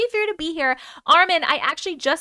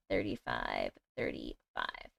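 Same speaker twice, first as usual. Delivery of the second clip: much lower and slower, dropped deep; deep silly voice; 15 dB quieter, slower than usual. Acted. Telling viewers the start time in a deep put-on voice. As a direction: growl the numbers low.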